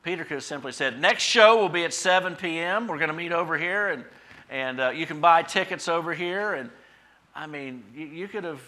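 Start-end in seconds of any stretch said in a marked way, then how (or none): 1.0-6.5 loud, raised mock announcer voice; showman's announcement. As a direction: pitch it to the crowd like a promoter. Imagined Peter as a showman.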